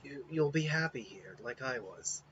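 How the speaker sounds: soft and weary, fading out; frail Kimimaro. Acted. Sickly Kimimaro greeting Naruto, quiet and weary. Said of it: "And it was like".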